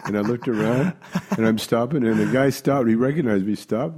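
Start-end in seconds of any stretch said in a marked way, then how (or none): none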